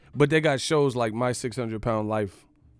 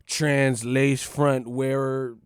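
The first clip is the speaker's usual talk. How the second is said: slow reading; slower than usual. Retold or imitated. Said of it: "It was like".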